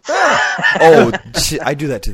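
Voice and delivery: loud, high laughing exclamation; surprised laugh. Real, not acted. Host hears a familiar blunder and bursts out, loud and laughing. Recognizing the same habit.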